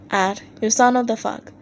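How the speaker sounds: ordinary delivery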